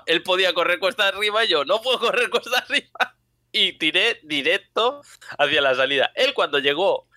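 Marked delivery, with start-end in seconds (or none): 0.1-5.0 laughing. Real, not acted. Laughing indignant retelling.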